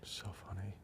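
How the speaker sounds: soft unvoiced whisper. Real, not acted. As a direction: breathe it out. Whispered aside.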